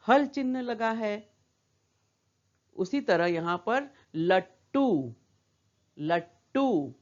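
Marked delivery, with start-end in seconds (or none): 4.1-6.9 लट्टू as 'lat-too', drawn out with a held ट; half consonant. Shown as a demonstration. Teacher modelling the word for children, लट्टू as 'lat-too'. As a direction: stop on the half ट, then release a long टू. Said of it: "इसको हम आधा ही बोल रहे हैं".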